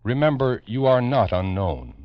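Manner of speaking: usual speaking voice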